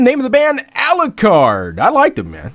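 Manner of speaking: ordinary talk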